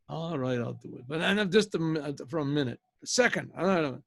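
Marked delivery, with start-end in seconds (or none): 0.1-1.1 acted grudging consent, dropping low and soft; mock resigned agreement; reluctant giving in. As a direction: give in half-heartedly, fading away. Retold man grudgingly agreeing.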